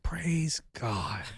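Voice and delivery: under-the-breath whisper; quiet prayerful whisper; whispered praise. Retold or imitated. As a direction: breathe it out, to yourself. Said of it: "I'd be like".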